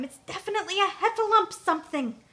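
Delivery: high, loud, urgent warning; squeaky alarm. A narrator's reading. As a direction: insist nervously that danger is near. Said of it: "whispered Piglet a little louder this time".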